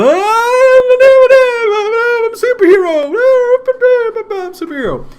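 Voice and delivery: fake kid voice. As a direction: squeal like a little kid being tossed around. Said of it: "does the voice for the kid".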